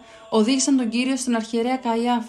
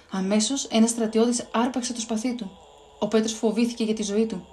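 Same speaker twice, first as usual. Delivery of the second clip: usual speaking voice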